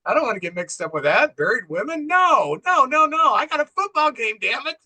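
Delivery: high-pitched mock indignation; exasperated refusal. Football fan brushing off a woman's plea, mock exasperated. Retold man refuses to get involved.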